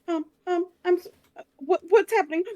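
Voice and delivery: high, loud frantic pleading; panicking mother under attack; panicked. Acted. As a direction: babble in terror as arrows fly. Liv panics in an ambush.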